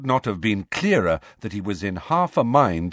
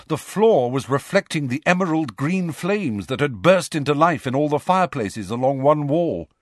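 usual speaking voice

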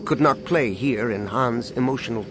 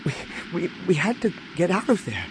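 higher, halting, softer excuse; stuttering; 6 semitones higher, 4 dB quieter than usual. A narrator's reading. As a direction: stammer on the first word, flustered. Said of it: "Anakin stuttered over several replies".

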